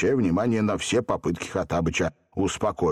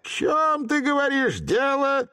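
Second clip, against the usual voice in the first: высокий насмешливый переспрос; с иронией переспросить; ironic question. A narrator's reading. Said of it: "иронически переспросил". (иронично)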